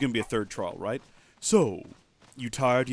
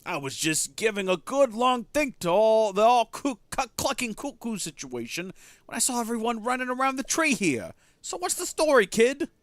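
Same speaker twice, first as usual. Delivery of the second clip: much higher and louder, slow hearty call; booming friendly partner; 11 semitones higher, 8 dB louder than usual. Acted. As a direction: greet your old partner boisterously. New character greets loudly.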